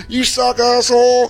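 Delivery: high, hollered insult; bullhorn heckle. Acted. Fan with bullhorn at Rucker Park, high heckling yell. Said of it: "she's just got a fucking bullhorn".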